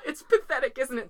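high, loud, laughing mock-despair; laughing self-mockery. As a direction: giggle through it, mocking your own weakness. Mocking herself with trainers.

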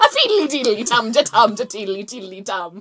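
loud lilting mock-Irish nonsense; Irish accent. Shown as a demonstration. Showing the key phrase for Irish, hammed-up accent. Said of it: "With Irish, of course".

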